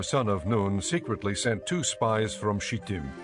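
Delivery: ordinary talk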